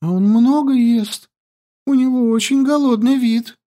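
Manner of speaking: put-on high, lively young girl; girl's voice. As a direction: chatter high and breathless. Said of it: "Yandi voice".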